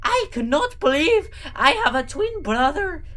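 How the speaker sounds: high-pitched, cartoonish Gru accent; squeaky mock-Gru accent; weird Gru accent. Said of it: "in his weird Gru accent".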